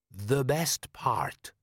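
put-on slow, accented, ceremonious elder voice; desert wise man. A narrator's reading. Wise man answers his foreign guest, slow and stately. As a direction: intone it slowly as a foreign sage.

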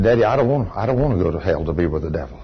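put-on low, hushed retelling of a child's plea; quiet plea. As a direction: quote a small son softly and gravely. Retold small son pleading with father.

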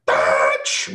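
low, loud growled cry; sudden monster yell. Retold or imitated. Retelling the scary scene, low loud yell. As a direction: bark it out like the jump scare. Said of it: "and then it was like".

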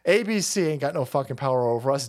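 ordinary talk, nothing marked

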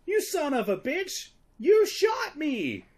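high, slow, hammed-up yell of a man just shot; mock outraged shout. Security guard screams at the Terminator, hammed-up shout. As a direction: bellow it like a wounded guard. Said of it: "the security guard says".

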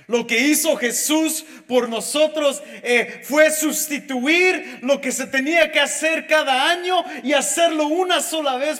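loud, driving sermon voice; full-force preaching. Acted. Pastor preaching Good Friday to his church, loud and driving. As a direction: preach it out to the congregation.

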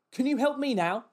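high, loud, curt demand; really rude. Retold or imitated. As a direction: imitate a really rude customer demanding help. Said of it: "Like, really rude".

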